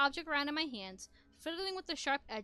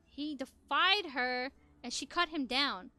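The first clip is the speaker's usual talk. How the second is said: slow and grave; 5 dB louder, slower than usual. Acted. Grim memory.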